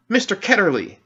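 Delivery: put-on girl shouts the name in sudden recognition; shouted. A narrator's reading. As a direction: blurt the name as memory snaps back. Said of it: "she shouted out".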